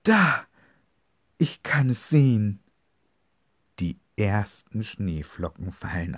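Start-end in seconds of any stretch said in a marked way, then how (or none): 0.0-2.6 excited. Acted. Brother sees first snowflakes.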